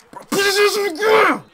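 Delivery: very high, very loud scream of rage; furious shout. Real, not acted. Gamer screaming at his player to go down, furious. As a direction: yell it at the screen at full volume. Yelling at the game.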